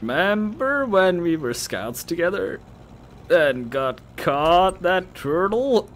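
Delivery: put-on high, slow, loud drunken drawl; nostalgic drunk girl. Drunk girl reminding friend of scouts, stretched-out slur. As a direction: drag out every word with drunken enthusiasm.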